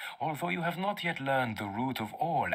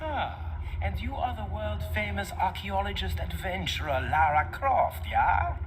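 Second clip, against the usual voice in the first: high, sneering mock praise of her fame; sarcastic mockery; 9 semitones higher than usual. Old mentor mocking his pupil Lara, sneering. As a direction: taunt her with fake admiration.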